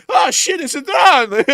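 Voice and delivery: high, loud panicked yell; hammed-up shriek of sudden alarm; very scared. Soldier spotting a drone mid-daydream, scared yell. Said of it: "he's very scared".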